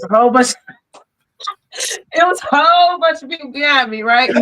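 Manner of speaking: high, giggly storytelling; laughing while telling. Real, not acted. Guest laughing through her skirt story, high and giggly.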